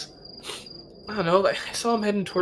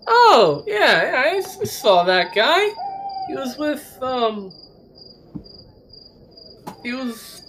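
put-on drunk party guest; 7 semitones higher, slower than usual. Drunk witness NPC rambling.

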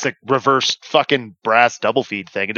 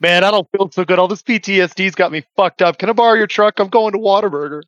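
lightly voiced mock veteran, higher; a hint of a whiny, rattled soldier; mock shell-shocked vet; 7 semitones higher than usual. Friend teasing the buddies as a traumatized sniper, mock whiny.

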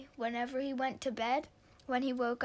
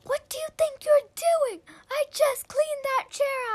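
much higher and louder furious yell; 11 dB louder than usual. Acted. Boy yelling at his sister for sitting on his chair, furious yell. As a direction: scream it at her, furious. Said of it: "Alex yelled".

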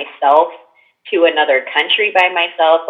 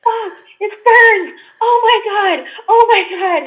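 screaming in pain. Retold or imitated. Retelling the eye drops burning her eyes, a high pained scream. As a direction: shriek it out like your eyes are on fire.

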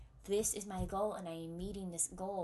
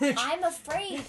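loud, slow, high mock-dramatic; 8 semitones higher, 13 dB louder, slower than usual. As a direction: echo it with a theatrical flourish. Repeats dramatically.

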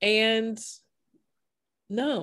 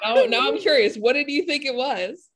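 excited; 6 semitones higher, 5 dB louder than usual. Real, not acted. Eager question after her laugh.

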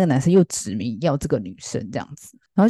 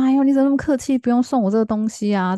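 bashful protest; 8 semitones higher than usual. Retold or imitated. Girl bashfully refuses a gift.

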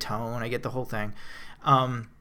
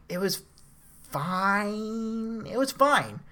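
high, drawn-out "fine"; grudging approval; 8 semitones higher, much slower than usual. Real, not acted. Reviewer judging the movie's last scene, grudging approval. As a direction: stretch it out high, unconvinced it was any good.

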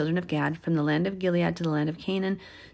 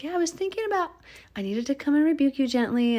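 high mock lady voice, pitched well up; playful high-voiced imitation of a kind woman; imitating the lady; 8 semitones higher than usual. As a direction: chirp it up high like a gentle church lady. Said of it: "the lady's like".

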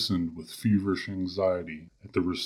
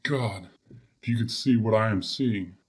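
usual speaking voice

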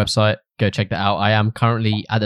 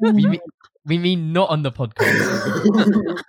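laughing; 8 semitones higher, much slower than usual. Real, not acted. Laughing at the mix-up.

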